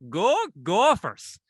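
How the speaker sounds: drawn-out, high, loud mock Minnesota accent; weird Minnesota accent. Imitating a random guy at the game, weird Minnesota accent. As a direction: stretch it out like a Minnesota fan's odd cheer. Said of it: "like, s-, weird accent".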